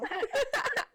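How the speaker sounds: high burst of laughter. Real, not acted. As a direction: break into a high giggle. Laughs at forgetting name.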